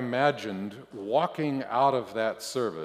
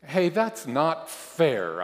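high, loud, drawn-out complaint; squirming protest; 6 semitones higher, 7 dB louder, slower than usual. A narrator's reading. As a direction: protest unfairness, dragging the words. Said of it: "I squirm".

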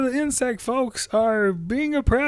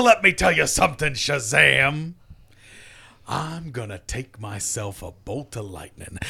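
low promo menace; 8 semitones lower, 6 dB quieter than usual. Acted. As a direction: growl the threat at Shazam.

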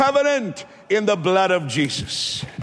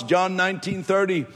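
normal talking voice

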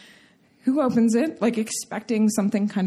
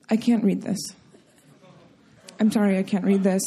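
lower, subdued somber refusal; 5 semitones lower, faster than usual. Retold or imitated. Aunt opening a slip of paper, somber. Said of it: "becomes very somber".

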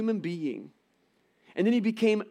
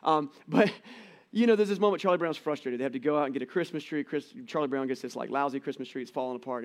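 usual speaking voice

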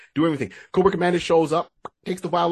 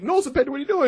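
mock alarmed cry; 12 semitones higher, faster than usual. Repeating the alarmed protest, corrected name.

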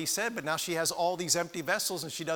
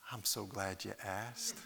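soft and glad; 7 semitones lower, 11 dB quieter than usual. Acted. Widow answers the neighbor.

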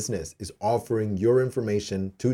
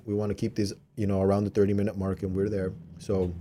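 ordinary talk

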